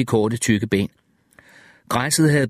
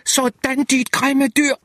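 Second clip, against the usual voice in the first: high-pitched scream of triumph; 13 semitones higher, slower than usual. A narrator's reading. Jack screams at the shot alligator, high-pitched scream. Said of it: "skreg Jack".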